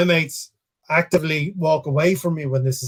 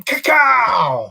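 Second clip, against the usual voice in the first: loud high shouted magic word; 7 semitones higher, 4 dB louder than usual. Pretend. Announcing a flyer on the podcast, a shouted magic word. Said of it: "I'm gonna do a bit of magic".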